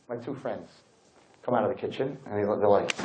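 normal talking voice

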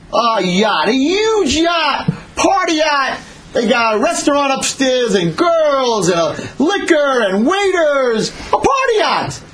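high, drawn-out exclamations of wonder; over-the-top amazement. Acted. Son marvels at huge yacht.

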